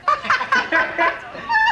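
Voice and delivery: demo audience laugh. Shown as a demonstration. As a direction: laugh big to sell the joke. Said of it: "See, then you get your laugh".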